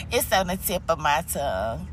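ordinary talk, nothing marked

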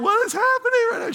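put-on high, fast panicked little girl; mock frantic child voice; freaking-out child. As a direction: play the scared toddler in the tube. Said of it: "Ella is freaking out".

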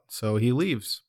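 usual speaking voice

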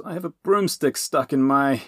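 high, loud, strained complaint; pained and cut off; gasped. A narrator's reading. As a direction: gasp it out under squashing weight. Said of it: "Robin gasped".

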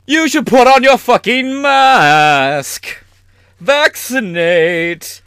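high, slow, hammed-up Freddie voice; mock [name]. Mocking [name] lecturing folks to mask up, high and slow. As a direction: stretch it out, flamboyant and preachy. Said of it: "[name] would a thousand percent be".

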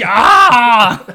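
loud mock scream of a wizard; hammed-up battle yell. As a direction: throw out a big fake cry. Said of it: "screaming in whatever word".

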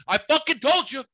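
high, loud imitation of an upset sister; mock-frantic "told you so" outburst; exasperated retold scolding.

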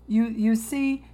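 ordinary talk

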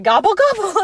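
high, loud, playful imitation; giggly phone chatter. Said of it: "she's giggling to her sister".

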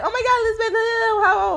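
high-pitched mock banter; imitating a jokey, harmless tease; joking tease. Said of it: "it's not just, like, a joke".

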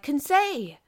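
a high, loud anguished cry of his name. A narrator's reading. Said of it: "I cried".

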